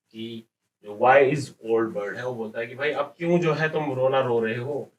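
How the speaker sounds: ordinary talk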